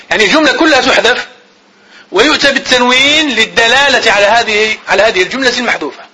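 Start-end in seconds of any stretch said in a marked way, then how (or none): none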